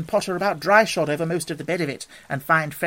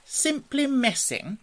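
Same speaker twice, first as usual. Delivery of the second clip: high, slow, lingering reverie; dreamy; 9 semitones higher, slower than usual. A narrator's reading. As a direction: drift off blissfully on the words. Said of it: "he went on dreamily".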